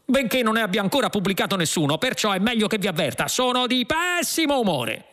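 high, hurried grumpy cop; touchy second policeman. Acted. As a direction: rush out a high-pitched warning as the cop. Other policeman warns of bad mood.

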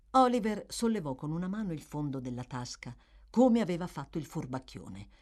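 ordinary delivery, nothing marked

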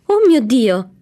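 loud, high exclamation of alarm; crying out. A narrator's reading. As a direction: cry out like a startled doctor. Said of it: "gridò il dottore".

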